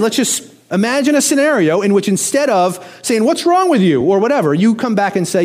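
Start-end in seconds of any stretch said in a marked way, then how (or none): 3.2-4.0 mock angry retort. Preacher imitating a snapped comeback at a rude person, mock angry. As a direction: throw the question back at them, irritated.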